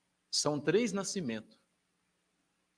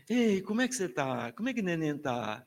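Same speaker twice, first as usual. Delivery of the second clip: entoação de falar com bebê. Shown as a demonstration. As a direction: greet a baby with exaggerated intonation. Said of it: "É a entoação". (entoação carinhosa de conversar com neném)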